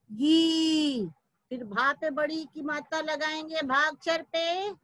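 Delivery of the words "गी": गी with a long, sustained ee; long ee sound. Shown as a demonstration. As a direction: hold the vowel of गी for the class. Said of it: "फिर उसका उच्चारण करेंगे". (गी को लंबी ई से खींचकर)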